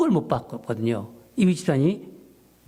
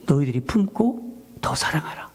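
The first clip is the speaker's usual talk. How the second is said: whispered divine command; whispered inner voice of the Lord. Retold or imitated. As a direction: breathe it like a voice heard in the heart. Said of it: "한 주님의 음성이, 예, 마음에 들렸어요".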